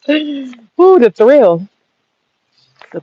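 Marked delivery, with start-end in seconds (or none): 0.1-1.0 relishing ooh. Pretend. Relishing slapping fantasy.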